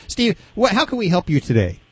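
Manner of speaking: ordinary talk, nothing marked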